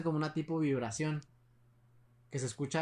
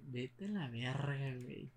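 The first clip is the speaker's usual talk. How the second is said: murmullo asustado; 9 dB quieter, slower than usual. Retold or imitated. Reacción de miedo al enterarse.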